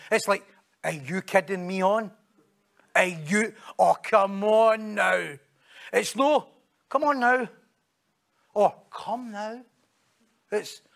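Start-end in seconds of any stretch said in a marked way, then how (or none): 0.8-5.3 drawn-out, incredulous protest; can't-believe-it reproach; exasperated disbelief. Shown as a demonstration. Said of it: "See if you say it like that". 6.9-7.5 raised, punchy reproach; incredulous protest; exasperated. Shown as a demonstration. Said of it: "See if you say it like that". 8.9-9.6 soft, rising "come now"; mild chiding; gentle reproach. Shown as a demonstration. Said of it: "See if you say it like that".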